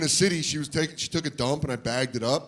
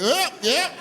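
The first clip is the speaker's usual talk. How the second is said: put-on much higher, louder little-kid voice; relieved kid; 13 semitones higher, 5 dB louder than usual. Kid set back down, high relieved squeak. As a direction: squeak with relief as feet land. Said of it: "you're like".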